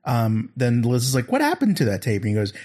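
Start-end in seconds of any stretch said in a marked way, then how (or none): 1.3-2.2 high-pitched mock questioning; imitating Liz. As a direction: lightly voice a curious woman, pitched up. Voices Liz asking about tape.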